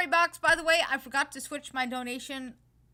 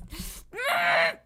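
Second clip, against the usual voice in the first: exasperated laugh; 6 semitones lower than usual. Real, not acted. Laugh breaking up the rant.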